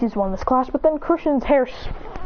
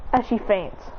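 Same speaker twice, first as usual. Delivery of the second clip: plain reading voice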